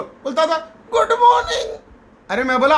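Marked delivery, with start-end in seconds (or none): 0.9-1.8 a high, small, timid good morning; timid mumble. Retold or imitated. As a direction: say it like a nervous boy afraid to speak. Shy trainee forced to greet. (डरा-सहमा धीमा good morning)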